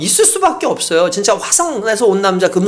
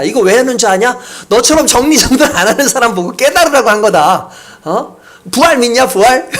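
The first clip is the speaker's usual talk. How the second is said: mock stern rebuke; 3 semitones higher than usual. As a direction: hammer her with the resurrection. Husband preaching at wife. (점점 격해지는 꾸중)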